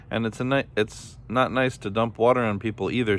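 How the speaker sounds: usual speaking voice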